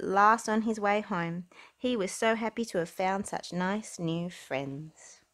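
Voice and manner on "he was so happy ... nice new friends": ordinary delivery, nothing marked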